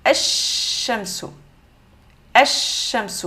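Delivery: "ash-shamsu" with the L silent and a long held SH; slow "ash-shamsu", doubled SH drawn out; lam assimilé. Shown as a demonstration. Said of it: "on l'entend plus". (« ash-shamsu », lam muet, chine allongé)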